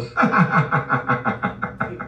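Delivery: long cackle fading away; mocking laughter. Real, not acted. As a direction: laugh it out till it trails off. Ridiculing the boast.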